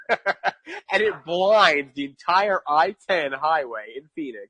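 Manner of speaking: slow words broken by real laughter; laughing through it. Real, not acted. Telling the friend about the smoke while laughing. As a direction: laugh as you tell it.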